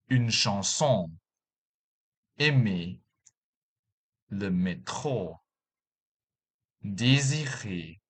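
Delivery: careful French pronunciation with final-syllable stress; French words. Shown as a demonstration. Teacher demonstrating French word stress to learners. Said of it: "For example".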